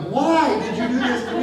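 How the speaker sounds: laughing, mock outraged protest; half-laughing why-me cry; laughing mock complaint. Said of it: "I'm like".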